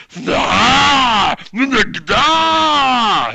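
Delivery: loud, high monstrous bellow; orc roar. Acted. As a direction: roar wordlessly like a joyful orc at full volume. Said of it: "to them it sounds like".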